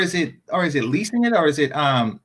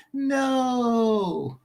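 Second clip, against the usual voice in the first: a small pained "No." held in, higher and softer; subtle inside cry; 5 semitones higher, 5 dB quieter than usual. Retold or imitated. Mocking a rival's pained reaction, quiet inner cry. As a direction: wince out "No." like a hidden cry. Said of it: "just like a subtle inside cry".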